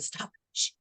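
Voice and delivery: urgent breathy whisper; hushed shush. A narrator's reading. Mama mouse hushing her babies, whispered. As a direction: hush them without a sound.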